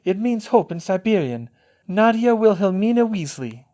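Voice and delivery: high, loud and decided; firm. A narrator's reading. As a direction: announce the full name firmly. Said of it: "Fleur said firmly".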